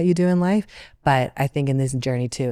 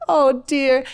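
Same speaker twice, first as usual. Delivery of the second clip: much higher, louder mock oh dear; sympathetic friend; 11 semitones higher, 7 dB louder than usual. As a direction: coo it like a fussing friend. [name] reacts to her state.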